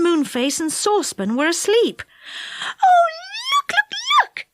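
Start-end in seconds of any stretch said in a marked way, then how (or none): 2.8-4.5 very high excited squeal. A narrator's reading. Fairy spotting her lost friends, squealing with excitement. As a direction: squeal it out, pitch right at the top. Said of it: "squealed Silky".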